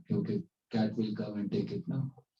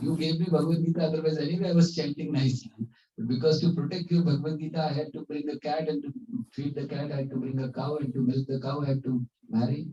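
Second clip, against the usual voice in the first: higher, hurried excuses; protesting self-defence; 5 semitones higher than usual. Retold or imitated. Disciple blaming guru for his marriage, rapid protest. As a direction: imitate a disciple rattling off his justification.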